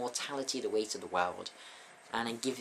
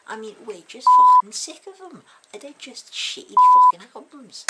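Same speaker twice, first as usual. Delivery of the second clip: loud, higher ranting fans in a Scouse voice; mock fan yell; 21 dB louder than usual. [name] quoting angry fans, shouting. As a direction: bellow it like furious fans.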